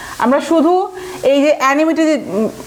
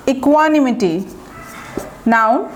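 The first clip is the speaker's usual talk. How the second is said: ordinary talk, nothing marked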